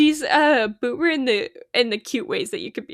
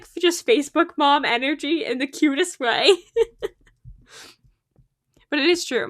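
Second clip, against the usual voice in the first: ordinary delivery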